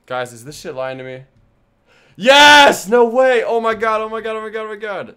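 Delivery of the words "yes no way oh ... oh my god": ecstatic high screaming; shrieking jackpot winner. Real, not acted. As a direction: scream with disbelieving joy. Emote gets approved, screaming.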